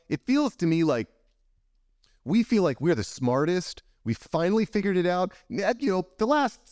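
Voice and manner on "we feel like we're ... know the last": higher-pitched know-it-all boasting; mock smugness. Guest voicing people who think they're the smartest, mock-smug. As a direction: play up humanity's self-satisfaction, pitched up. Mocks humanity's smug self-belief.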